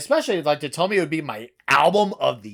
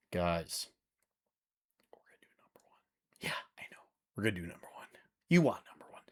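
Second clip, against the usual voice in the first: hushed, secretive whisper to the audience; 10 semitones lower, 14 dB quieter, much faster than usual. Acted. Reviewer teasing viewers before number one: a whisper. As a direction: lean in and whisper the big reveal. Teasing reveal of the top pick.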